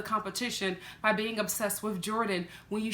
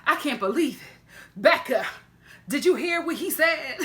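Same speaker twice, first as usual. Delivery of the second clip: sassy gossip; 5 semitones higher, 5 dB louder than usual. Retold or imitated. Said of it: "they're just like".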